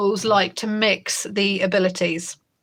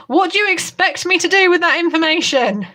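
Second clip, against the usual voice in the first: sarcastic retort; 9 semitones higher, 4 dB louder than usual. Retold or imitated. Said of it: "And I'm like".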